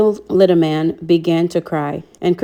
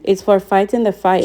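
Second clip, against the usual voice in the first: usual speaking voice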